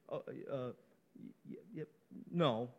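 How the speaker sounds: very soft, stammering mock-timid answer; silent, hesitant no. Shown as a demonstration. Preacher showing how the answer is not given, hesitant. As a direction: fumble weakly toward a barely-there NO. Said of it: "It's not a silent".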